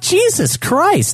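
high, drawn-out Jesus Christ; fed up beyond words; slow, high exasperation; exasperated groan. Retold or imitated. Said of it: "I'm like".

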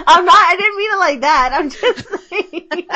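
laughing through a flustered apology, pitch up. Real, not acted. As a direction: blurt it out between laughs.

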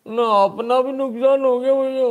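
high, loud, drawn-out mock crying; mock wailing. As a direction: wail in exaggerated misery, mocking the losers. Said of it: "अब रोते रहो". (रोने का नाटक, ऊँचे सुर में खींचकर)

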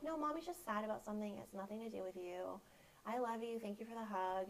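put-on soft, tender reassurance to a child; quiet loving mom voice; reassuring. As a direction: gently tell your kid it's not their fault. Mother reassuring her kids.

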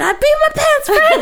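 high laughing shout; mock yell. Running inside to tell Jacob, laughing shout. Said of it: "I said".

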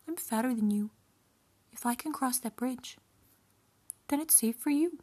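high-pitched goat character; middle goat. A narrator's reading. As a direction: make a case in a high little goat voice. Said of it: "said the middle goat".

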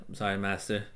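a meek apprentice voice, low and quiet; sorry-master whine. Retold or imitated. Imitating Anakin apologizing to his master, low and soft. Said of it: "he has a very, like".

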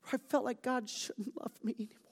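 choked, whispered confession; emotional. Real, not acted. Pastor confessing his own doubts, emotional whisper. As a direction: let it break into a whisper. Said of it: "sorry, I'm getting really emotional".

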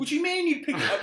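high, loud incredulous question through a laugh; can't believe he said that, laughing; laughing disbelief. Real, not acted. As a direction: laugh at the idea as you ask. Mocking friend's claim, laughing.

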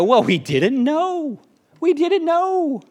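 high, slow, whiny protest; mock helpless excuse. Ancestors excusing themselves to great-great-grandkids, whiny and helpless. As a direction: draw out a feeble plea of innocence.

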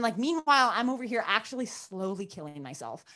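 usual speaking voice

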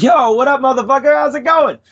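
hype, high, bro greeting; friendly greeting. Retold or imitated. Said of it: "Or you could be like".

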